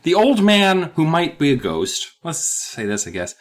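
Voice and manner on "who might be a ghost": normal talking voice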